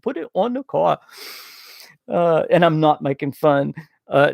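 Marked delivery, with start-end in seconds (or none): 0.0-1.0 higher-pitched mock Boston accent; playful stab at Boston speech, dropping the r. Shown as a demonstration. Host showing viewers the accent, imitation Boston accent. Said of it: "is the Boston accent".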